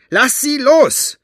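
very high, loud, drawn-out shout; piercing, urgent scream. A narrator's reading. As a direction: scream the demand slowly and with full force. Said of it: "schrie Maggie".

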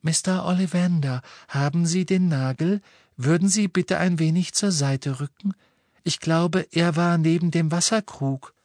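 put-on high, polite girl's voice; gentle high-pitched request; Luna. A narrator's reading. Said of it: "konnte Harry Luna sagen hören".

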